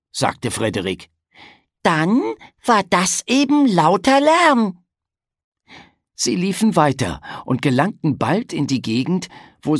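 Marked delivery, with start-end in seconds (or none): 1.8-4.8 high, louder, slow little-pig voice; drawn out, pleased with himself; childlike conclusion. Acted. Piggledy tells his brother the jet was loud noise, high and drawn out.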